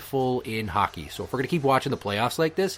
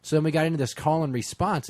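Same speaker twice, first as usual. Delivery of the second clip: ordinary talk, nothing marked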